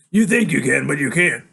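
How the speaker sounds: loud, firm contradiction; insistent denial. Acted. Father dismissing son's memory, loud insistent denial. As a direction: overrule him loudly.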